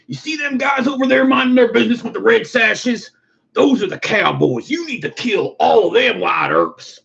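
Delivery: high, urgent put-on voice; Western townsman. A townsman urges Wyatt Earp to kill the cowboys, in a high urgent voice. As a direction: warn the lawman, rising to a shout.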